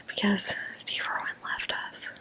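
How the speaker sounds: very soft, whispered lament; hushed mourning. Pretend. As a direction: drop to a mock-sorrowful whisper. Mock mourning of Steve Irwin.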